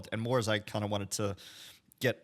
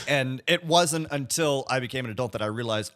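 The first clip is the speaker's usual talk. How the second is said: ordinary delivery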